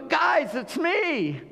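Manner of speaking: high, bright-eyed hey it's me; cheerful reveal. Retold or imitated. Joseph to his brothers, a mock happy reveal. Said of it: "He didn't go".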